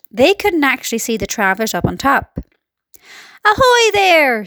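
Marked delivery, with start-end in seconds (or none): 3.4-4.4 high, long-drawn call; police chief calling up high; shouted hail. A narrator's reading. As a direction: hail someone far above, stretching the words. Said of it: "shouted the chief of police".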